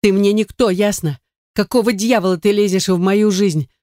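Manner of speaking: high, heated tirade; acted indignation, agitated and high; angry outburst. A narrator's reading. Hysterical sister berating Vander.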